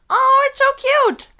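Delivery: high, loud excited squeal; squealing with delight. Real, not acted. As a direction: gush over something adorable. Squeals over a cat item.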